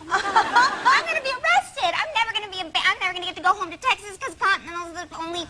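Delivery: retelling her panic on the plane; mock panic. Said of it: "I was all nervous".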